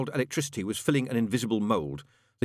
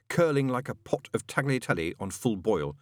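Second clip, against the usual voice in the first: plain reading voice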